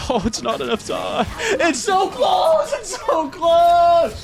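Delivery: high, slow, stretched-out yelling; high drawn-out anguished shouts; frustrated shout. Real, not acted. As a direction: yell in agony at missing it. Run fails by seconds.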